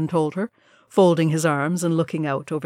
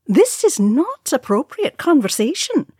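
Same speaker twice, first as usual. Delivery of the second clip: towering dissatisfaction; 10 semitones higher, 5 dB louder than usual. A narrator's reading. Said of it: "with towering dissatisfaction".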